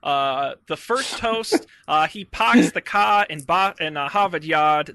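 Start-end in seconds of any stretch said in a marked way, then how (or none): 1.9-4.9 a hint of Boston, laughing through it; mock Boston accent. Host teasing his Boston co-host, lightly mock Boston accent. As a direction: drop the r's like a Bostonian, playfully.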